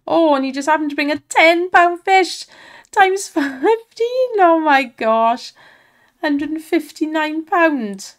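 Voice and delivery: loud, high, laughing disbelief at a big win; real thrilled outburst, breaking into a laugh; excited shouting. Real, not acted. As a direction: shout it out, stretching the big words. Huge fish lands, big win.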